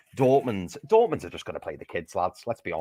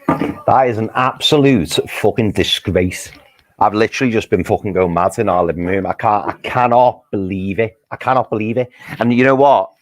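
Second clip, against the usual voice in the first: furious rant; 11 dB louder than usual. Real, not acted. Fan fuming to his mates about a robbed penalty, loud outrage. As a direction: bellow your disgust at the ref.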